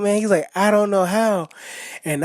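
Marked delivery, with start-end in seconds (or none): 0.5-1.5 mock yell. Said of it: "he yelled out".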